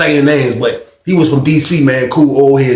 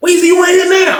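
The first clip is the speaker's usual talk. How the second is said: excited call. Retold or imitated. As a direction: call his name like you can't believe he's here. Old friend spots him, calling out.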